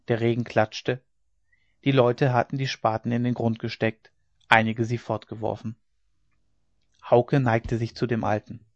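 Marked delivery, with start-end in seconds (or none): none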